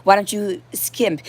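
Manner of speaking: ordinary talk, nothing marked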